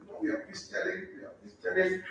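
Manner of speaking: high, breathy mock whine of a complainer. Minister mimics people who only talk about challenges, in a high hushed mock whine. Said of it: "whosoever is talking, just".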